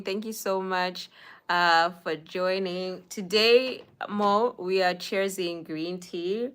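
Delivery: usual speaking voice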